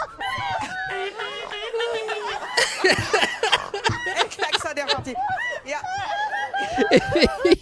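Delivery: helpless high-pitched laughing fit; long high laugh. Real, not acted. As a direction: laugh until breath runs out. After snort laugh clip.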